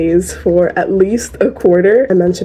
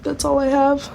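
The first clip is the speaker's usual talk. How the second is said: strained, higher voice from a sore throat; 7 semitones higher than usual. Real, not acted. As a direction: push words out through a sore throat. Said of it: "My throat is sore".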